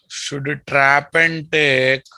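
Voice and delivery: each word slow and deliberate; slow dictation. Shown as a demonstration. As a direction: spell it out slowly for notes. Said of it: "teacher dictating slowly".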